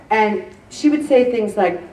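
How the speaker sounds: normal talking voice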